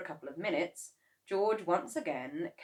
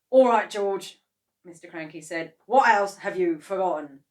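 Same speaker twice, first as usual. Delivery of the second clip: stern demand; 2 semitones higher, 13 dB louder than usual. A narrator's reading. Mr Kranky demanding.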